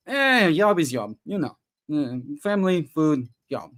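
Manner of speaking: put-on a lazy Russian accent, job is job; mock Russian accent. Cosmonaut brushing off his launch in mock Russian accent. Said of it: "Was that an idiot accent slipping into".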